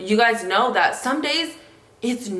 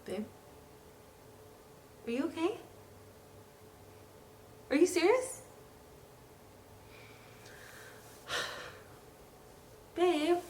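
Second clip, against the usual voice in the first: soft, hushed and higher, comforting her partner; tender comfort; 7 semitones higher, 9 dB quieter than usual. Real, not acted. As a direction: gently soothe someone who is upset. Partner comforting him while he cries.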